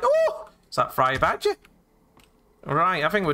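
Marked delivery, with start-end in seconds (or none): 0.0-1.5 high-pitched mock delight; imitating happy greeter. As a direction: lightly voice a pleased character spotting a friend. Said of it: "He's like".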